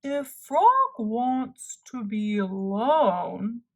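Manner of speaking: very slow, drawn-out turtle voice. Acted. Turtle advises Toad on the way, very slow. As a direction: speak like an unhurried old turtle.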